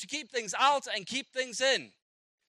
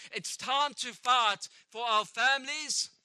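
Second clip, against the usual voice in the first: slow solemn commission; 3 dB louder, slower than usual. Retold or imitated.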